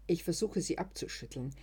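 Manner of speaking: plain reading voice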